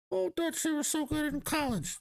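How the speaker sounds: high mock-fan falsetto, poking fun. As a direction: parrot a naive fan in a high put-on voice. Mocking an imagined hopeful fan.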